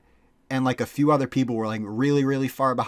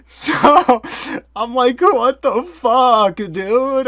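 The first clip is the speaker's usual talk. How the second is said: panicked; 11 semitones higher, 8 dB louder, much slower than usual. Retold or imitated. Scared kid fleeing strange man.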